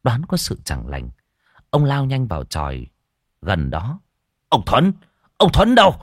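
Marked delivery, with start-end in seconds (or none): 4.5-5.9 loud, high shout for someone; urgent calling out. A narrator's reading. Official rushes to the hut calling for a man, urgent and loud. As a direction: holler to summon him at once.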